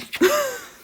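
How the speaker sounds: sudden high laugh at herself; high burst of laughter. Real, not acted. Laughing while listing her insecurities.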